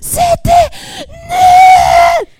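high shouted punchline. Acted. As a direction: yell it out high and loud. Montage quip, shouted punchline.

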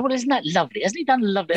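high, sweetly delighted mum; proud mum. Retold or imitated. Mother praising her son's punk record over tea, high and sweet. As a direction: gush like a mother who missed the lyrics. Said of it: "She said".

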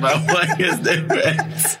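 laughing swagger. Shown as a demonstration.

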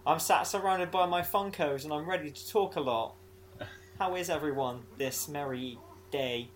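upbeat podcast host intro; cheery welcome. Pretend. Host redoing his gloomy intro for listeners, cheery and upbeat. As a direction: lift it brightly, then stretch the merry greeting.

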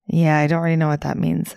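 ordinary talk, nothing marked

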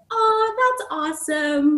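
high, sugary fake enthusiasm; mock polite brush-off. Teen mimicking principals' polite brush-off, sugary fake cheer. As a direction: sound thrilled while meaning no. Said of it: "they were kind of like".

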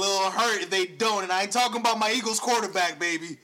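fired up about the comeback; excited. Real, not acted. Excited wrestling hype.

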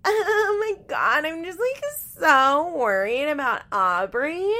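mocking high, loud, drawn-out baby talk; Chelsea's baby voice. Host mocking a young mother at daycare, baby voice. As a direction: imitate a whiny baby voice, stretched and exaggerated. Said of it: "watching Chelsea be like; talking in her baby voice".

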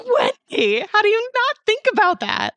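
high, loud hammed-up disbelief; mock exasperation. Daughter scolding mom Wendy, high and loud in mock exasperation. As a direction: throw your voice up high in playful outrage.

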